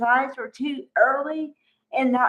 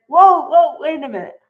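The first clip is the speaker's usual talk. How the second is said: breaking up a fight; 3 semitones higher, 9 dB louder than usual. Retold or imitated. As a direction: call it out to split them apart.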